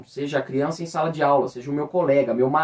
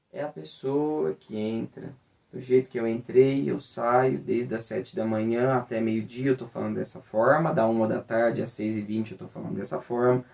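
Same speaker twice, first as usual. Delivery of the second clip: lower, softer and flat; apathetic intonation; 4 semitones lower, 4 dB quieter than usual. Shown as a demonstration. Voice teacher demonstrating a bored monotone to teachers, lower and softer. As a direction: drone on listlessly with no melody. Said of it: "uma entonação apática".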